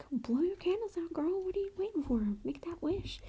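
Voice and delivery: high, eager whispered cheer; excited urging. Retold or imitated. Urging the birthday girl to make a wish, in a high eager whisper. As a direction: egg her on to blow out the candles, in a whisper.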